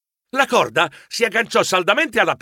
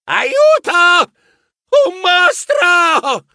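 much higher, louder cry for help; hammed-up high, loud panic; panicked cry; 13 semitones higher, 6 dB louder, much slower than usual. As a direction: scream for help, high and slow. Narrator steps on something, panics.

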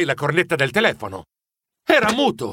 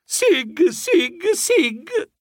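high, squeaky acted sobs; hammed-up storybook sobbing; 11 semitones higher, much slower than usual. A narrator's reading. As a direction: sob in little high bursts.

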